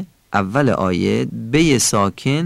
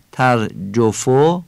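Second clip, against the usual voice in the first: "ترجفو" with Persian o vowels; Persian accent; much slower than usual. Shown as a demonstration. Teacher shows the tone to avoid, Persian accent. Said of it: "این فارسیه".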